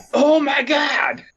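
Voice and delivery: high, drawn-out fanboy cry; overexcited fan shout. Retold or imitated. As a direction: shout it slowly in exaggerated awe. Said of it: "he's just like".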